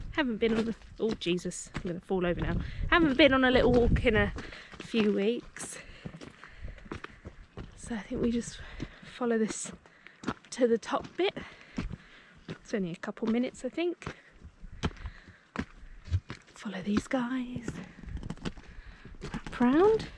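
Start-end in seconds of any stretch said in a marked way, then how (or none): 0.1-5.8 breathless from the climb; puffing, short of breath. Real, not acted. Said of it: "Oh, I'm out of breath". 16.5-20.1 hushed whisper, slowed. Real, not acted. Following people on the trail.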